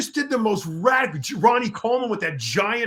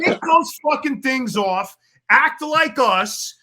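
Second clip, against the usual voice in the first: raised, slow, mocking shout; loud ribbing; 6 semitones higher, 5 dB louder, slower than usual. Real, not acted. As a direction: yell it at him teasingly. Ribbing friend over headphones.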